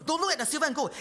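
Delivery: a hint of an urgent author speaking; voicing the author. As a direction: lightly act the author pleading to look at people. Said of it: "He said".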